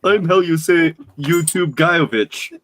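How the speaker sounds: slow, hammy fake Russian accent. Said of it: "giving the fake Russian accent".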